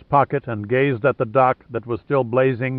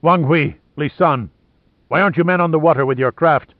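louder, fast angry demand; 3 semitones higher, 3 dB louder than usual. Acted. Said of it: "He stared at them angrily".

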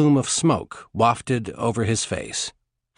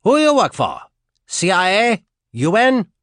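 loud high bark of questions; shouted interrogation; 9 semitones higher, 6 dB louder than usual. A narrator's reading. Soldier keeps yelling at the prisoner. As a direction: keep yelling the demands.

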